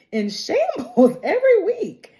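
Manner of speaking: high-pitched, half-laughing whine; playful wail. Real, not acted. Fan wrecked by the show, high comic wail. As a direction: squeal it in comic despair. Exaggerating how emotional the show makes her.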